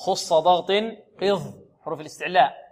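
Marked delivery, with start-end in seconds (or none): none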